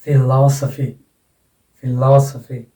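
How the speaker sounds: "Philosophy" as fi-LOS-uh-fee, strong "लॉ"; stress on LO. Shown as a demonstration. Teacher models the suffix rule, stress on LO. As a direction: punch "लॉ" in "philosophy", then fall away. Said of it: "accent यहाँ पर लग जाएगा".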